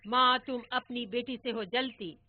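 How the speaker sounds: loud playful teasing couplet; teasing rhyme. Retold or imitated. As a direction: rib the mother with a rhyme. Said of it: "और दादा चिढ़ाते".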